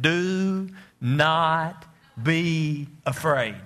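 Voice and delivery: slow, drawn-out and ominous, like a film narrator; slow, portentous movie-line read; dramatic movie-style delivery. Shown as a demonstration. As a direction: stretch each word out solemnly. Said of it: "here's the way I said it in the early service".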